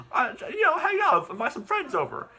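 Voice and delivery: put-on high-pitched kid character; voiced boy. Son explaining the sleepover to Dad, high-pitched.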